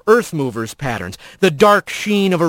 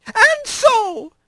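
high, thin, carrying lizard call; thin reedy voice. A narrator's reading. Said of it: "his reedy voice carrying".

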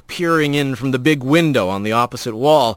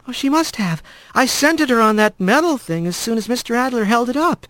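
put-on higher, casual girl voice; matter-of-fact young mouse; 8 semitones higher than usual. A narrator's reading. Friend explaining to Rat, light and higher. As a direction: state it plainly. Kylie voice.

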